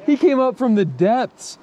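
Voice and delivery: high, loud excited call; thrilled the fish rose. Real, not acted. Friend watching the fish rise, excitedly calls out.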